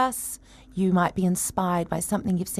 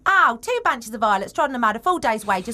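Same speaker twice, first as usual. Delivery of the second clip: put-on loud Cockney flower girl complaining; Eliza's Cockney warm-up line, loud and higher; Cockney accent; 5 semitones higher, 5 dB louder than usual. Actress demos her Cockney warm-up line, loud Cockney. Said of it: "Like for Cockney".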